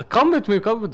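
high, loud urgent call. Real, not acted. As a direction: call out to a teammate. Urgently calling teammate along.

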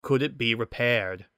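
usual speaking voice

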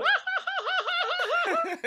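very high, slow comic shrieks with laughter; Giovanni Vázquez screaming. Retold or imitated. Imitating a famous panicked scream for a friend, high shrieks. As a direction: shriek like a man trapped in an elevator. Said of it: "como Giovanni Vázquez en el ascensor".

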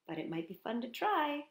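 ordinary delivery

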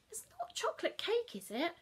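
brisk morning question; Mum asking. Acted.

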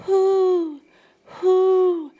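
high loud hooting owl call. A narrator's reading. Owl calling to Brooke, loud high hoots. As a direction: hoot like an owl.

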